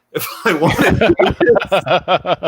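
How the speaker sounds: words stretched out through laughter; laughing. Real, not acted. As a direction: laugh it out slowly. Laughing at end of story.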